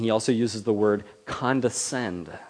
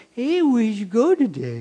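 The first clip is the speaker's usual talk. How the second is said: put-on much higher, louder sing-song toddler talk; baby talk; 12 semitones higher, 7 dB louder than usual. Shown as a demonstration. Adult chatting to a lisping toddler in baby talk. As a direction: talk up high like to a two-year-old. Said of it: "Have you ever seen somebody do that?".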